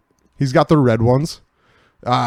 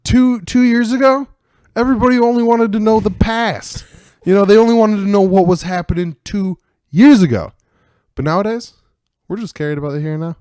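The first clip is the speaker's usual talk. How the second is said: hammed-up enthusiasm; 7 semitones higher than usual. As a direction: gush about it, over the top. Hammed-up nowadays bit.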